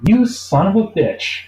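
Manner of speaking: incredulous curse. Retold or imitated. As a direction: savour the disbelief, drawn out. Reacting to no-overtime claim.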